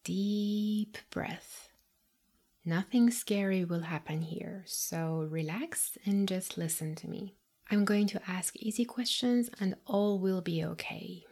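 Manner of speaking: soft close whisper; calm whispered reassurance; right in ear. Acted. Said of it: "calming intro whisper in ear".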